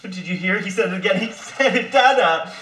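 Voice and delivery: high, loud, laughing gush; excited father. Acted. Father cries to mother that baby spoke again, overjoyed. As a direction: bubble over that he said it again.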